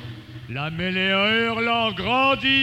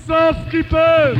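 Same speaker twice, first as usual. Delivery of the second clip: repeated panic cry; 6 semitones higher, 10 dB louder than usual. Acted. Rout crying out to fleeing soldiers, loud alarm. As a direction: shout it with weight on the final word. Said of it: "s'écria".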